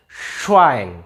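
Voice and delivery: "Schwein" begun with a long hushing sh; sch sound. Shown as a demonstration. Teacher showing viewers how SCH reads, long sh. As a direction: say "Schwein" with a drawn-out sh onset. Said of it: "Nih, S C H".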